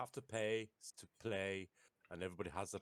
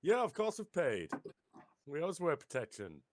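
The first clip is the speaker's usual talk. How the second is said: player character, bluffing; 8 semitones higher, 5 dB louder than usual. Acted. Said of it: "I'll just go".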